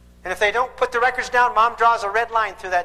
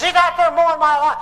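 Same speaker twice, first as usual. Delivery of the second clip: put-on high, loud kid's protest, the son complaining; upset kid; 8 semitones higher, 7 dB louder than usual. Son to dad about the neighbour boy, high and loud protest. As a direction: cry out like an indignant boy. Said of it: "He says".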